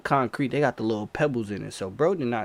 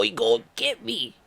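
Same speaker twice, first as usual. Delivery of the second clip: retold alarm; 10 semitones higher, 3 dB louder than usual. Retelling his alarm while being chased.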